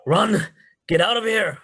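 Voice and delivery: urgent warning shouted across the scene; loud, high yell to get them moving. Acted. Yelling to two companions to flee the fight, shouted. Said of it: "I'm gonna yell to Gavin and Caedmon".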